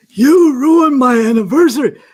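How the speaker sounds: loud, high furious yelling customer; mock the customer yelling at him; angry yell. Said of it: "he yelled at me so much".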